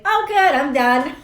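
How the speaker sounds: bright burst of relief; relieved and chirpy. Retold or imitated. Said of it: "And I'll be like".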